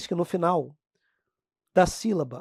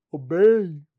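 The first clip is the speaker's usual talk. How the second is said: mock nasal; 5 semitones lower, 4 dB louder than usual. Shown as a demonstration. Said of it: "extremamente fanho".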